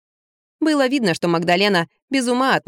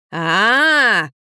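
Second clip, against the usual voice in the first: drawn-out "aaah" yell; wailing yell; 3 dB louder than usual. A narrator's reading. Enraged mother over her daughter, drawn-out yell. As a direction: open up into a long rising-falling cry. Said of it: "завопила маменька".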